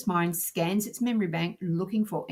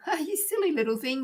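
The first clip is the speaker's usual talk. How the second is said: much higher, amused teasing; lightly chuckled, narrated father's tease; laughingly; 9 semitones higher than usual. A narrator's reading. Father comes to his confused little son, speaking laughingly. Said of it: "laughingly said".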